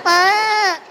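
high bleating whine; whining sheep. Retold or imitated. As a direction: forlorn sheep left behind.

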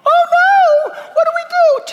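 squeaky high hammed-up panic; mocking panicked people crying out. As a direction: play the frightened crowd, falsetto and loud.

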